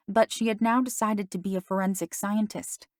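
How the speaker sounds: usual speaking voice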